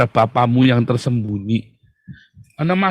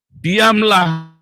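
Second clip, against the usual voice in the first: Diamlah called out higher and louder; firm command; 6 semitones higher, 6 dB louder than usual. Shown as a demonstration. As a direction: bark "Diamlah" as a firm order. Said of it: "tenanglah supaya kamu dapat berdoa".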